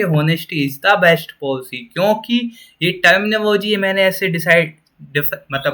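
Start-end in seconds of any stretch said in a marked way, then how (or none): 0.0-1.9 "Honesty is the best policy" read very slowly with "the" stressed; correct order, read slowly. Shown as a demonstration. Teacher showing the correct sentence slowly. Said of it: "Always यही लिखा मिलेगा कि".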